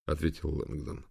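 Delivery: ordinary delivery, nothing marked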